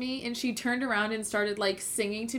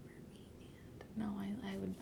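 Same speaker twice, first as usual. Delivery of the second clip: whispering; 17 dB quieter than usual. Real, not acted. Trying to recall names.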